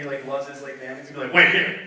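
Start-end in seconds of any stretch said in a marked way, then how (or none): 1.3-1.8 loud, angry mock command; real mad. Angry husband tells his family to stay put, loud. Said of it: "gonna get real mad".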